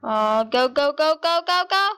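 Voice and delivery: normal talking voice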